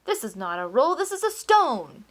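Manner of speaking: loud, rising to a high furious shout; angry shout. A narrator's reading. Duke throwing the roll down, shouting in anger. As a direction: hurl the words at him.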